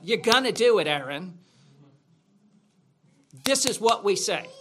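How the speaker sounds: hammed-up Southern tough talk; Southern accent. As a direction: threaten in your best Southern accent. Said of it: "put on my best Southern accent".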